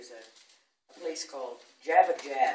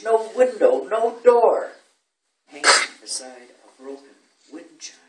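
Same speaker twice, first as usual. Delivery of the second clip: measured villanelle with raised, punched phrases; poetry recitation; 4 semitones higher, 8 dB louder, slower than usual. Acted. Recites own villanelle to audience.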